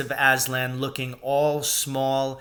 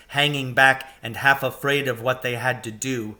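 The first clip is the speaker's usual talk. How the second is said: plain reading voice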